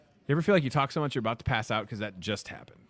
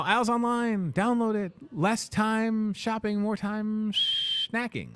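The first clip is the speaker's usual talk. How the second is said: high promo voice; 10 semitones higher, slower than usual. Acted.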